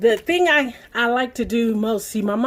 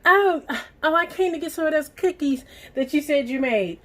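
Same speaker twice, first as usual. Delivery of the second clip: sister's voice; 7 semitones higher than usual. Retold or imitated. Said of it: "my sister came down here and was like".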